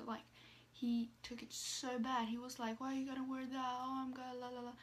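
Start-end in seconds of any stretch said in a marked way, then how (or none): 2.8-4.7 mild mock complaint in dad's words; angry dad, retold. Daughter mimicking dad objecting to her niqab, lightly angry. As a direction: imitate an angry dad, trailing into la-la-la. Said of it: "He was so angry about it".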